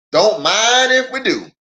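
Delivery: country neighbor says yes; Lem accepts. Acted. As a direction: take the dinner invite gladly.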